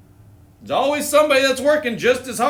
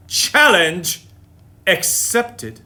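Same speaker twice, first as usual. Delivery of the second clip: hammed-up movie-hero line; dramatic challenge accepted; much slower than usual. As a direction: say it like a film showdown. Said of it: "She probably didn't say it like that".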